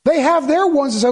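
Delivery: ordinary talk, nothing marked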